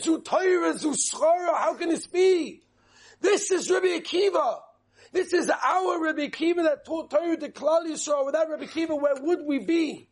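high, anguished cry held to the end; angels crying out. Retold or imitated. Angels crying out over Rabbi Akiva's death, high anguished cry. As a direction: cry out in disbelief, pitched well up. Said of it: "the Malachim screamed out and said".

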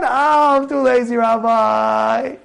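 long, drawn-out whining excuse; mock feeble whine; lazy whine. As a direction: moan it out to get out of learning.

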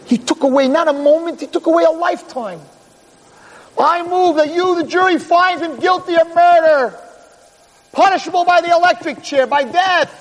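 high, ringing courtroom oratory; fired-up summation. Acted. Energized DA urging the jury to convict, fervent and high. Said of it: "out of breath and he's all excited".

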